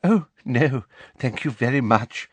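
lightly simpering politeness; a touch of simper. A narrator's reading. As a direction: decline with sugary courtesy. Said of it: "with that simpering laugh".

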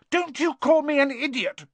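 very high indignant retort. A narrator's reading. Neville answering Ron's insult, indignant. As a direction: bristle at the insult, pitch soaring.

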